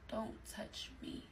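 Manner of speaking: slow, barely audible whisper; very soft, slow whispered warning; hushed refusal. Retold or imitated. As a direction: re-enact a refusal under your breath, drawn out. Repeats refusal, turned away in re-enactment.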